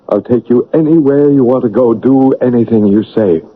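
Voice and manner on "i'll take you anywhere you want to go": ordinary talk, nothing marked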